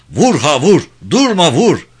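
high, loud rhythmic urging; battle frenzy. A narrator's reading. Soldiers in bayonet fight, urging with loud cries. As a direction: swing each word like a blow.

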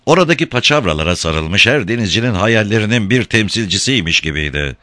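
ordinary delivery

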